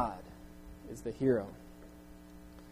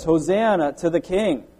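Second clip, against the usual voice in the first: raised, louder acclamation of a king; mild shout; 3 semitones higher, 7 dB louder than usual. Acted. Preacher voicing the Old Testament hailing the King, mildly shouting. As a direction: proclaim it loudly, short of a full shout. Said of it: "shouting for us".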